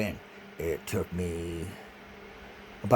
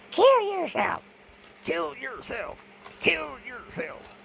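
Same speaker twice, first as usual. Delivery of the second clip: much higher, slow taunting chant; mocking taunt; 5 dB louder, slower than usual. Mimicking the threatening voices, high parade-like taunt. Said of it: "threatening me going".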